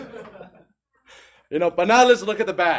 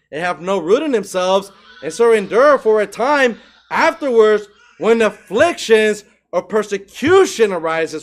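loud, raised-pitch scripture reading, slowed on key phrases; preaching scripture; 3 semitones higher, 7 dB louder than usual. Acted. Preacher reads Mark four aloud to his congregation, loud. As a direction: proclaim the parable to the church.

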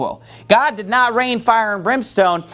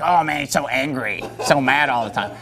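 mocking accuser; 6 semitones lower, 4 dB quieter than usual. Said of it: "it's like".

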